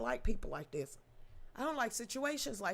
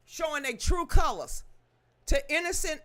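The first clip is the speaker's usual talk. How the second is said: louder, heated ranting; loud outraged rant at the judge; angry; 4 semitones higher, 8 dB louder than usual. Real, not acted. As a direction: push it out in outrage.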